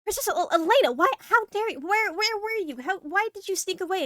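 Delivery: high, loud flustered outburst of relief; worried princess, pitched well up; relieved scolding. Acted. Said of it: "like, sighs of relief".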